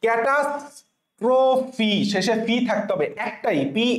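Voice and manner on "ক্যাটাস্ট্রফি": ক্যাটাস্ট্রফি drawn out slowly, ending on a clear fi; teacher models ক্যাটাস্ট্রফি slowly; slow pronunciation. Shown as a demonstration. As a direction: spell it out in sound, slowly. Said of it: "স্পেলিংটা হচ্ছে ক্যাটাস্ট্রফি".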